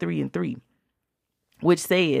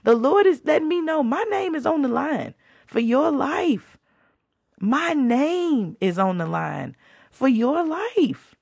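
emotional; 8 semitones higher than usual. Retold or imitated. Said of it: "I was like".

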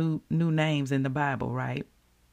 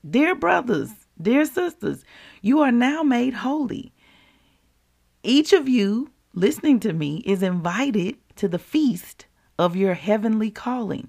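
higher-pitched preaching straight at the listener; raised, rousing scripture delivery; direct, emphatic address; 5 semitones higher, 3 dB louder than usual. Shown as a demonstration. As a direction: proclaim it personally to each listener. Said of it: "let me say it to you like this".

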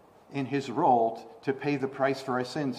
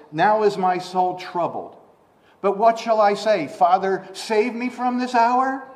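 raised voice climbing into a question; troubled plea; 7 semitones higher, 7 dB louder than usual. Acted. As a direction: build louder and higher toward the question. Jesus' prayer quoted as rhetorical question.

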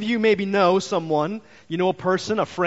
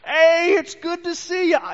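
much higher, louder, overjoyed hello; excited greeting; 13 semitones higher, 6 dB louder, slower than usual. Retold or imitated. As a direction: greet him like a long-lost friend. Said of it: "He's gonna be like".

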